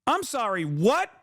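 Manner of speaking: mock disbelief, voice climbing; shocked disbelief. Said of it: "They go".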